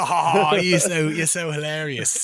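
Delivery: put-on slipping from Australian into a light British accent; a hint of British mockery, slow at the start; mock Australian accent gone British. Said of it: "I went British there for a sec".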